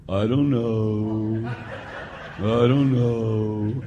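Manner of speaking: put-on slow, low, laughing mumble of a shrugging kid; mumbled teen answer. Priest imitating students who can't answer, slow mumble. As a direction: drag it out like a clueless teenager. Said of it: "the number one answer ... is what?".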